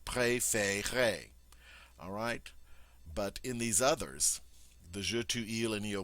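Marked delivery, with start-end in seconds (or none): 0.0-1.3 préférez slowly, with a long A in each syllable; slow model pronunciation. Shown as a demonstration. Teacher modeling the vous form préférez slowly. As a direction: carefully sound out préférez at a slow pace. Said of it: "The same with the vous form".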